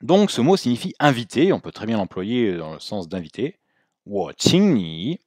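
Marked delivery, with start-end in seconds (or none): 4.1-5.2 我请你 drawn out, tones clear; careful Mandarin teaching demo; slow model phrase. Shown as a demonstration. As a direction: give each syllable its full tone. Teacher models example slowly.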